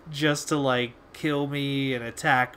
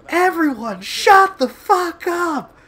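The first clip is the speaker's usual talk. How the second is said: big mock yell, much higher and louder, stretched slowly; joke yell; 12 dB louder, slower than usual. Gamer yelling at a noisy lobby as a joke, huge mock shout. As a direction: belt "shut the fuck up" as a jokey blast over everyone. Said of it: "Like that, just as a joke".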